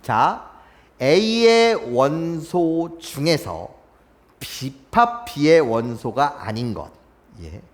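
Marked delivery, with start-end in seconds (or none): 1.0-6.9 slow demo reading. Shown as a demonstration. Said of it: "teacher reads definition slowly".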